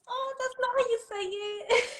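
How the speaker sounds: very high-pitched, softened correction; gentle correction. Retold or imitated. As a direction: break it to the child in a tiny high voice. Parent correcting child's funny accent.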